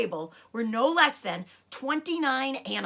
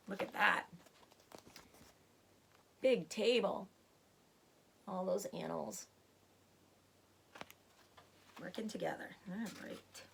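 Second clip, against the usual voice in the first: quiet aside; 2 semitones lower, 9 dB quieter than usual. Real, not acted. Aside, fading quiet commentary.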